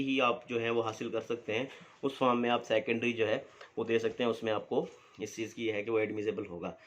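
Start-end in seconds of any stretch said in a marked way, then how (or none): none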